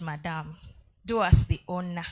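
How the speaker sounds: ordinary delivery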